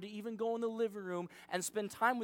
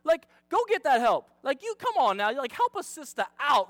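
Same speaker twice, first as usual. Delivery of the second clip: high, loud, sassy encouragement; acted rooting, punched up high; cheering her on; 6 semitones higher, 6 dB louder than usual. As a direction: whoop it like an eager supporter. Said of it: "I'm like".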